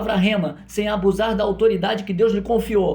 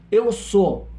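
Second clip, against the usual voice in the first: emphatic two-word declaration; Jesus's declaration; 3 dB louder than usual. Retold or imitated. Preacher quoting Jesus as highest authority, firm declaration. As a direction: set both words down firmly. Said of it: "ele diz assim".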